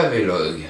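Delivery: Vlog said as two syllables, "vé-log"; mispronounced Vlog. Shown as a demonstration. Vlogger owning up to his wrong pronunciation, Vlog said "vé-log". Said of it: "on me l'a déjà dit".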